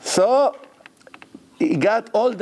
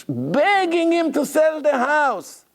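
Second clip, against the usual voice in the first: 1 pleading; 11 semitones higher, slower than usual. Retold or imitated. Retelling brokers' pleading.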